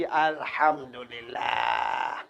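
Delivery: very slow, low, soft Alhamdulillah; a quiet, lingering word of thanks; soft drawn-out thanks. Acted. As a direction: breathe it out like a grateful sigh. Thanks after the prize.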